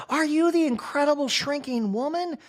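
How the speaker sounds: high disbelieving question; incredulous. Retold or imitated. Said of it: "Kyle incredulously goes".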